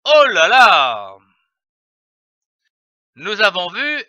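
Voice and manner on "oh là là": astonished. Shown as a demonstration. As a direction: marvel at something unexpected. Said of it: "pour exprimer un étonnement".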